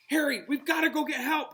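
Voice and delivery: high, loud urgent plea; frightened girl crying out; cried out. A narrator's reading. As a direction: cry out in panic for help. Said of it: "Hermione cried".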